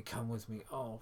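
ordinary talk, nothing marked

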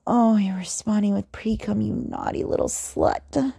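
ordinary talk

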